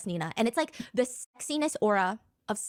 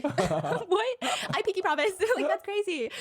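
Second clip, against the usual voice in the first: laughing disbelief; 9 semitones higher, slower than usual. Real, not acted.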